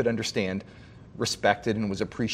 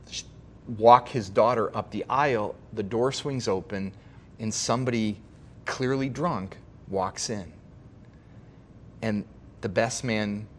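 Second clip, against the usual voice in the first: ordinary talk, nothing marked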